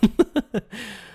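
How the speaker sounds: high, loud burst of laughter. Real, not acted. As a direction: laugh at the chaos. Laughing at the memory.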